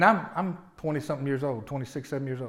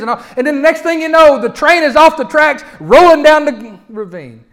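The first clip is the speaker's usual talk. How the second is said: loud, high, impassioned preaching; full-force preaching; 10 semitones higher, 12 dB louder than usual. Acted. Pastor preaching to the congregation at full force. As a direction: drive it home to the congregation.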